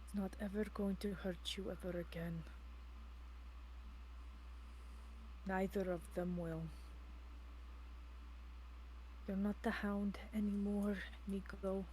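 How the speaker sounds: hushed, low, soothing voice; tender reassurance. Acted. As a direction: softly coax a loved one back to safety. Soothing a frightened transformed companion.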